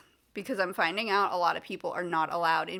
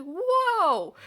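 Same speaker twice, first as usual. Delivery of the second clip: higher, louder drawn-out whoa; startled whoa; 10 semitones higher, 6 dB louder than usual. Retold or imitated. Patient taken aback by a receptionist's outburst, startled whoa. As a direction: recoil at the outburst. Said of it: "I was like".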